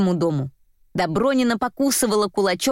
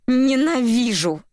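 slow, higher repeat of hatred; hateful cry; 4 semitones higher, slower than usual. A narrator's reading. Daughter struggles in father's arms, hateful. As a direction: stretch the word out, pitched up. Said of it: "проговорила она, оборвав вопли".

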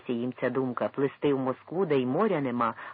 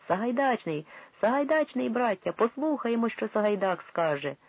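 1 eager crowd calling; 9 semitones higher than usual. A narrator's reading. Crowd calling his name.

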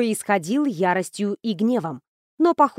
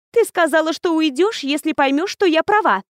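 insistent ghost bride; 6 semitones higher, 3 dB louder than usual. Acted. Ghost insists on being right.

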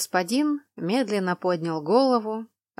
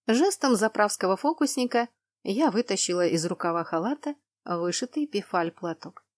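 ordinary delivery, nothing marked

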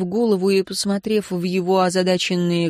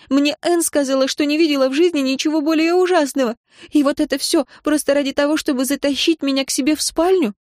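высоко и громко, сдерживая рыдания; защищать Аарона, почти срываясь на плач; горячая отповедь сквозь слёзы; 8 semitones higher than usual. A narrator's reading. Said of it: "с трудом удерживая себя от рыданий". (взволнованно, высоко, на грани слёз)

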